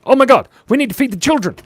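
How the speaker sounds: high, fast, loud alarm; mock panic. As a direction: blurt it out in a frantic rush. Panicked repeat of plea.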